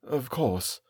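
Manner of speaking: soft, resigned agreement; sad. A narrator's reading. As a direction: agree sadly, voice dropping away. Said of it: "shaking her head sadly".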